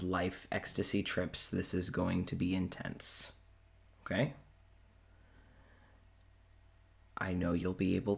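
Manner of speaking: low, quiet, measured warning with long pauses; grave Claudius. Acted. Claudius warns Richard of an intense experience, grave and low. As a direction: prepare them soberly, in a low, even voice.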